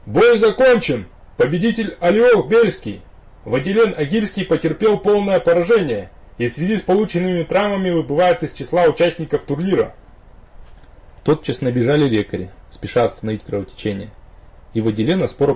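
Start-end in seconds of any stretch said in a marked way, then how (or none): 0.1-9.9 town crier. A narrator's reading. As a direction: announce it like a nasal herald. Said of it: "слегка гнусовый голос Герольда объявил".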